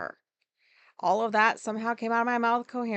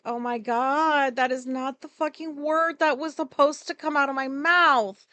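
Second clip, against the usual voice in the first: retold mortified outburst, higher and louder; horrified exclamation; 5 semitones higher, 5 dB louder than usual. Telling partner the wrong word came out, horrified. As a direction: stretch the "oh my god", then push higher and louder. Retold horror at own slip.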